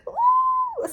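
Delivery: high crying wail Ooh; mock sob. Retelling breaking down in the taxi, a high sob. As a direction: burst into a sudden sob. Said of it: "burst out crying".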